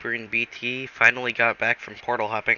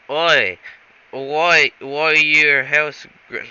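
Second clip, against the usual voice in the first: louder, higher mock British voice; a cockney-ish mock Brit; hammed-up British accent; 5 semitones higher, 7 dB louder, much slower than usual. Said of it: "he's not British".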